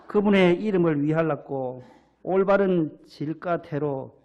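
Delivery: put-on hearty Jeolla-accented Psalm reading; imitated Jeolla dialect. Pastor reads the Psalm to amuse the congregation, in Jeolla dialect. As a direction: recite scripture like a Jeolla local. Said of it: "전라도 분들".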